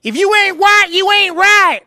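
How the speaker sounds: bellowing mock Southern accent. Shown as a demonstration. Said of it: "a Southern accent makes you sound even more racist".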